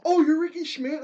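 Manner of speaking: high, amazed question; surprised recognition. Retold or imitated. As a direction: act starstruck at meeting him. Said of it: "they're like".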